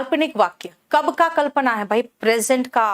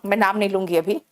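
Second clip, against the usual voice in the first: usual speaking voice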